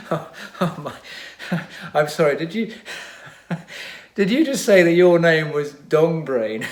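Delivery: high, giggling through the words; can barely get the question out for laughing; laughing hysterically. A narrator's reading. Ogmont mocking the villain's name, laughing hysterically. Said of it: "who was laughing hysterically".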